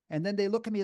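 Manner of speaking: normal talking voice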